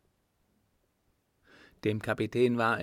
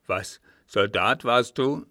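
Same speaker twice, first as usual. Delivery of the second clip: put-on loud, indignant old man; angry old captain, voice raised; grinding anger; 10 dB louder than usual. A narrator's reading. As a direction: snarl it loudly at an insolent servant. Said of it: "knirschte der Alte".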